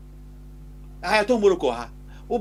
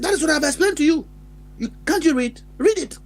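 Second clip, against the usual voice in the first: impatient father; 8 semitones higher than usual. Retold or imitated. Father snapping back at child.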